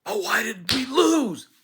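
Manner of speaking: mock the losing voters with a wail; hammed-up whining voter; whiny loser.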